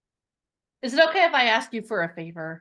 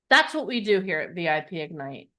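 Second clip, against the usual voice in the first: usual speaking voice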